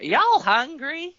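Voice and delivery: high, slow sweet grandma question. Retold or imitated. Grandma asks grandkids if hungry, high slow sweet voice. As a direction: call out like a doting grandma. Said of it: "she was like".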